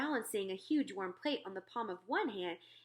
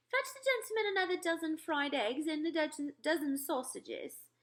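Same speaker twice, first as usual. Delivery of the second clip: regal voice, higher, slowing on dozen sausages; the Queen; 8 semitones higher than usual. Acted. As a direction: command the butler graciously.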